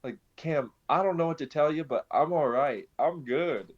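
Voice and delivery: high, bubbling excitement; retelling a joyful phone call to a friend, giddy and high; excited, overjoyed. Retold or imitated. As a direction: gush it out like you can't contain the joy. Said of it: "And I'm, like, jumping around".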